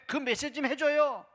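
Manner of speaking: pushy pleading. Retold or imitated. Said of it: "다그치고 책망하고 꾸짖었다는".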